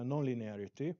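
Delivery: ordinary delivery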